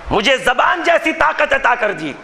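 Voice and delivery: normal talking voice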